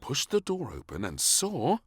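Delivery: plain reading voice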